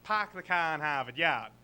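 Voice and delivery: broad Boston accent, dropping every R. Shown as a demonstration. Comic showing off the accent he lacks, broad Boston accent. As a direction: say it like a traditional Boston local. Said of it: "the traditional Boston accent. I don't say".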